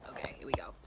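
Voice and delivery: soft unvoiced whisper. Real, not acted. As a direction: whisper it to yourself. Whispering while setting tripod.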